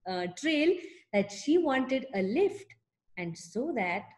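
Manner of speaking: plain reading voice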